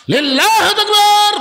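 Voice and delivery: very high, loud rallying cry; slogan shout. Real, not acted. Preacher leading the congregation in takbir, shouted. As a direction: belt it out and hold it for the crowd to answer.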